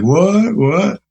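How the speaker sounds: high, rising what what, playing deaf; hammed-up can't-hear-you what; mock deaf what. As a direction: act like you can't hear a thing over the music. [name] pretending he can't hear.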